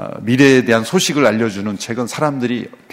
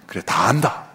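voice of God; 2 semitones higher than usual. Retold or imitated. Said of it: "하나님의 음성이".

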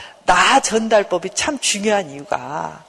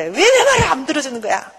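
high-pitched irritated complaint; 7 semitones higher than usual. Acted. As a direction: complain in exasperation, voice jumping up. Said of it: "막 짜증을 내".

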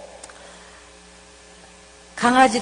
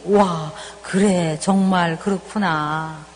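put-on drawn-out wow of approval for a child; impressed praise; 7 semitones lower, much slower than usual. Shown as a demonstration. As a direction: marvel gently at the child's answer. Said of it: "그렇게 하면 돼요".

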